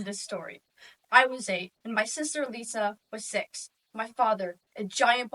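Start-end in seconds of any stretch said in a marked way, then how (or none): none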